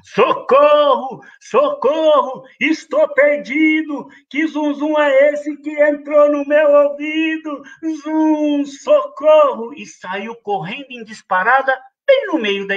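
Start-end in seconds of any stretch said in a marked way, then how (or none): 0.0-9.7 higher, slow mock cries for help; hammed-up panic; panicked yelling goat. Bully goat fleeing the mosquito, hammed-up yelling. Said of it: "começou a gritar".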